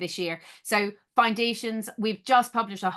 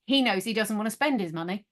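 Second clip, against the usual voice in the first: ordinary talk, nothing marked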